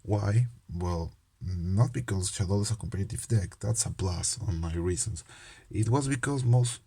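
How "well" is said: usual speaking voice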